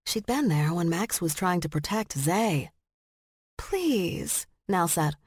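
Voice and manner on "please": polite smile. A narrator's reading. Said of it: "award-winning smile and ingrained old money politeness".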